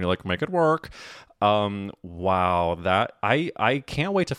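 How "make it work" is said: lightly mimicked catchphrase, a bit higher; a hint of a TV mentor's stock encouragement; Tim Gunn. Friend teasing her as a fashion mentor, light Tim Gunn catchphrase. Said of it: "You're like Tim Gunn".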